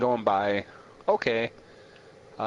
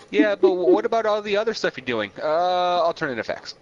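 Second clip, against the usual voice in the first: higher, louder, fast indignant rant; worked-up rant; 7 semitones higher, 5 dB louder than usual. Real, not acted. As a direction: rail at them, fast and loud. Mock rant at Trump administration.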